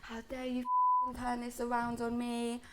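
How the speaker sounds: measured, high-pitched 'how dare you'; retold outrage. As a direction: re-enact going mad at someone, without shouting. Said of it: "And then I went mad".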